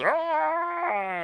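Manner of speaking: high piercing mock roar of grief; Klingon death roar. Superman copying Worf, a Klingon death roar. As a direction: let out a Worf-style anguished roar. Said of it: "his Klingon death roar".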